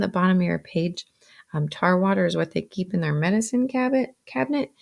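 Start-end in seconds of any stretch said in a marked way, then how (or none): none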